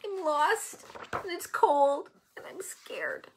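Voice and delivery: high, soft forlorn wail; small, high-pitched self-pitying whimper. A narrator's reading. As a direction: wail it softly, lost and scared. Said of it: "he wails".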